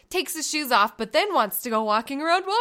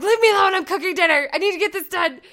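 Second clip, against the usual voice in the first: louder, higher mock snap; snapping at kids; 6 semitones higher, 5 dB louder, faster than usual. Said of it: "I just kinda snapped".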